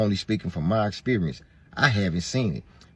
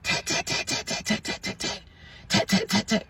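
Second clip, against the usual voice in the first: imitated knocking; 12 semitones higher than usual. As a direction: whisper the knocks like fingers on a screen door. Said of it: "tapping on the front screen, the glass".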